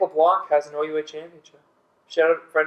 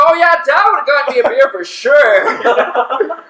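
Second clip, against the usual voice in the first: loud, high, drawn-out taunt with laughter in it; mock heckling shout; 10 semitones higher, 8 dB louder than usual. Storyteller acts out a friend piling on the teasing, slow high mock shout. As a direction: pile on with an exaggerated teasing yell.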